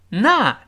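"那" (not) hit high with an open vowel; stressed "not". Shown as a demonstration. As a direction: punch the word "那" as in not. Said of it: "一定要重读". (重读“那”(not))

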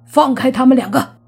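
shouting a fierce command; loud shout. Acted. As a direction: bark it out loud and high. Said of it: "莫凡大喝一声".

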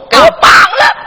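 pretend-angry shout, high and loud; mock angry order. Grandfather rehearsing a fake outburst at grandson, mock shout. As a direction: bark the order as a staged fury. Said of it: "我假装生气".

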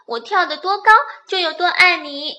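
high, loud little rabbit voice; 小兔子. A narrator's reading. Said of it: "小兔子笑着跳上跳下". (又高又响的小兔子声音)

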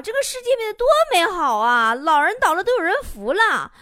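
high, effusive sighing over human goodness; moved exclamation. Acted. Passer-by watches people help old man, gushing with feeling. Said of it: "我正在感慨呀".

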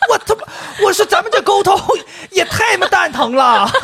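laughing through it, high and loud; laughing exasperation. Retold or imitated. Retelling a clueless caller, laughing in disbelief. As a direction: blurt it out while cracking up.

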